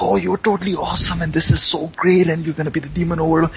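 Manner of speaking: high, excited fanboy rush; gushing kid. Acted. Kid fan hailing the new demon overlord, gushing. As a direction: gush like a starstruck kid. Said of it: "the kid's like".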